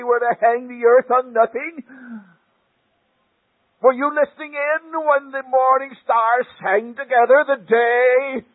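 God roaring from the tornado. Retold or imitated. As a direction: bellow the questions down at Job. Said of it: "That voice roared out of the tornado".

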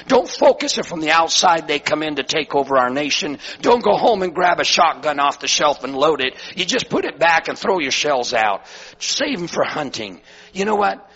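impassioned preaching. Real, not acted. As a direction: preach it with full conviction. Preaches at full force.

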